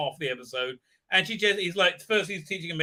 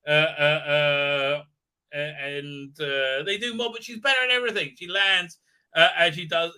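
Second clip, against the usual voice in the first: ordinary talk, nothing marked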